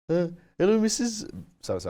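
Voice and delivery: very high, silly mock teacher voice; stupid teacher voice. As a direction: squeak it out like a ridiculous teacher imitation. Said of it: "had a voice like this".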